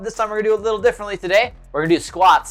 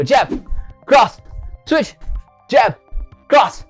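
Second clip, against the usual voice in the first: loud, higher calls; shouted drill commands; 6 semitones higher, 3 dB louder, much slower than usual. Shown as a demonstration. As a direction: bark out each strike. Calling out punch combo.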